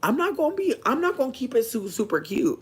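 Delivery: high-pitched retold plea to Nat; exasperated quote. Venting quote to roommate Nat.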